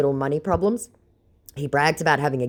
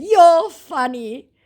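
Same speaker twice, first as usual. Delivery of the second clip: very high, very slow loud drawl; sarcastic sing-song; hammed-up smug scammer; mock sarcastic retort; 8 dB louder, much slower than usual.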